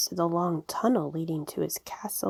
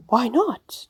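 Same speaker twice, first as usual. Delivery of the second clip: high, loud, startled question; surprised demand; 6 semitones higher, 5 dB louder than usual. A narrator's reading. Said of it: "demanded Grandfather Frog in great surprise".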